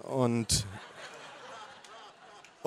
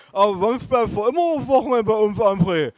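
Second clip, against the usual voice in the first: much higher, louder pushy voice; nagging relative; 8 semitones higher, 4 dB louder than usual. Acted. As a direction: press the question insistently. Relative insists.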